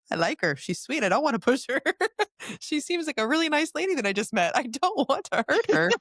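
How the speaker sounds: high, pleading little voice, laughing partway through; mock sweet protest. Healer voicing her reply to her guides about a nice lady, high mock plea.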